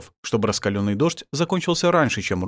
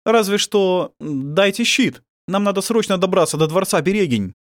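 lightly voiced higher young man; raised youthful voice; 9 semitones higher, 4 dB louder than usual. A narrator's reading. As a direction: ask as a hurried young rescuer. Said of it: "ответил молодой голос".

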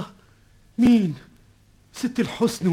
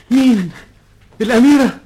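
high, much louder astonishment; startled exclamations; 5 semitones higher, 8 dB louder than usual. Acted. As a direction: shout in shock at the reveal. (دهشة عالية مصدومة)

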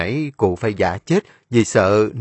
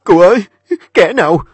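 high, loud, distraught pleading; voice cracking in fear; 12 semitones higher, 9 dB louder, faster than usual. A narrator's reading. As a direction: beg him to speak, voice breaking. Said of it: "giọng của nó lạc đi".

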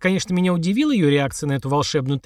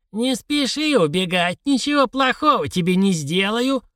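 put-on high, slow croak of an old hag; croaking old crone; 7 semitones higher than usual. A narrator's reading. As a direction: croak it into his ear like a crone. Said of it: "прокаркала на ухо костлявая".

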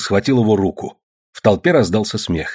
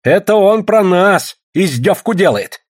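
loud, high, slow indignation; angered; 10 semitones higher, 5 dB louder, slower than usual. A narrator's reading. Young Old Believer feeling mocked, angry loud protest. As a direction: protest loudly, stung and offended. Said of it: "рассердился молодой старовер".

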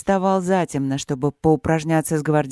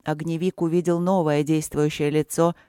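ordinary delivery, nothing marked

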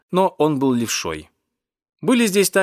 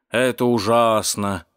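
ordinary delivery

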